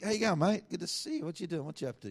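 light, friendly Australian chatter; casual Australian greeting. Retold or imitated. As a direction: greet him like a mate at the pub. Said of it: "you think".

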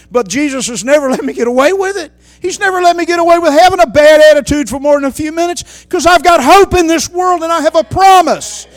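high, loud soaring sermon cry; fervent revival preacher in full flight; preaching at full force. Acted. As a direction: lift the crowd with each line. Preaching climax.

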